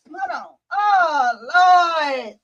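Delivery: high, strained crying shout; anguished cry to wait. Real, not acted. Puppy trapped, crying out.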